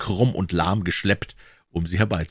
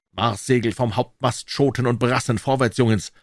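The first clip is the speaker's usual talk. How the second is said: usual speaking voice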